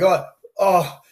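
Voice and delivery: hammed-up ache; pained groan. Acting out the pain of being right, pained groans. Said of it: "it just hurts so much".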